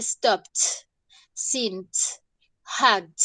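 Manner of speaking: "stopped, sint, hugged" each one syllable ending in a T; final T sound. Shown as a demonstration. Teacher showing students the past ending, final T sound. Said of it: "como el sonido de la T".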